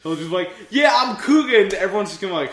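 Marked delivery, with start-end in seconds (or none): 0.7-1.6 high, loud mock hero shout; hammed-up heroic boast; proud introduction. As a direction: announce yourself to the crowd. Imagined hero introducing himself in crossovers.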